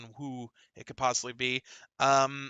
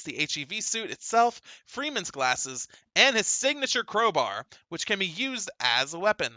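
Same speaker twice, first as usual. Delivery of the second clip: higher-pitched, energetic gaming news read; 4 semitones higher, 3 dB louder than usual. Acted. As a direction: read it out like an upbeat announcer.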